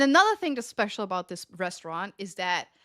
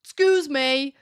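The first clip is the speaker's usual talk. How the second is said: higher-pitched mocking customer voice; complaining customer; 6 semitones higher, 5 dB louder than usual. Waitress mimicking the man at the next table, mocking. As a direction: mimic a fussy guest, trailing into mockery. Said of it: "the man next to the table, he's like".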